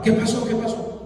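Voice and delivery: high, loud urgent questioning; worried. Retold or imitated. Husband demanding news from home, increasingly worried. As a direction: re-enact mounting alarm, pressing for news. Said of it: "Yo estaba preocupado".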